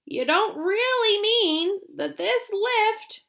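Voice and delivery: put-on a frightened old man protesting; high slow old grandpa, worried; alarmed Grandpa Joe. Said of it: "shouted Grandpa Joe".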